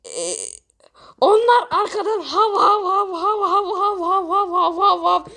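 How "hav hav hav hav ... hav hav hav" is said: playful woof woof; dog barking imitation. The contestant shows his talent with imitated barks. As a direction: bark like a little dog, over and over.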